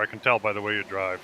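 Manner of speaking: usual speaking voice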